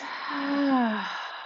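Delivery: soft long sigh out; demonstration sigh. Shown as a demonstration. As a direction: sigh out slowly and softly. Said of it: "And sigh it out".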